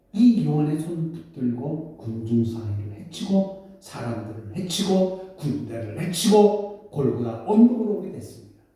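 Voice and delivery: ordinary talk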